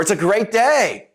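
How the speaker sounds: drawn-out cheerful exclamation; enthusiastic. Shown as a demonstration. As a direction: sell the line with big upbeat energy. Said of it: "I said two very different things".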